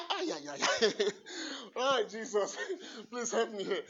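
laughing helplessly; laugh, unable to go on; overwhelmed laughter. Real, not acted.

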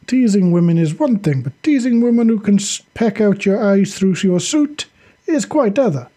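lightly voiced wisecracking squadmate; wry comrade. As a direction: rib your leader about his teasing. Voices Ivan joking.

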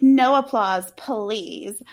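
exaggerated Valley Girl impression; put-on Valley Girl diva. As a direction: do a Valley Girl, drawing out "please.". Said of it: "my Valley Girl impressions".

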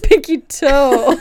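loud, drawn-out words through laughter; laughing. Real, not acted. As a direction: get it out while cracking up. Reacting through laughter to a mishap.

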